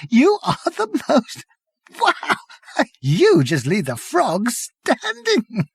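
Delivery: high, slow, helpless laughter; laughing. Acted. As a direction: wheeze it out laughing. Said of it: "howled and screamed with laughter".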